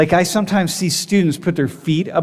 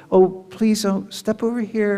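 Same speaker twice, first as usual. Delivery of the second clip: calm, kind agent; 5 semitones higher than usual. Retold or imitated. Korean security agent asking traveler to step aside, calm and kind. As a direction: guide them gently, very calmly. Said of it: "Very kind, very calm".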